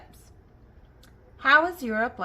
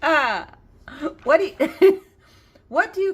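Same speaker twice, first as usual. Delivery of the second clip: high, loud burst of laughing speech; real laughter breaking up the words; 6 semitones higher, 9 dB louder than usual. Real, not acted. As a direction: crack up mid-question. Laughing too hard to read joke.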